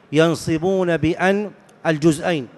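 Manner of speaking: normal talking voice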